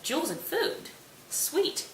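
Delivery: high-pitched happy disbelief; delighted surprise. Acted. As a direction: pipe up in mock delight. Bottom delighted by offered treats.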